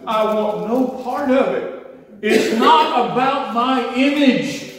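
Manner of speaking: high, loud, half-laughing cry; retold fervent prayer, high, loud and slowed; fervent plea. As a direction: renounce it with a joyful break.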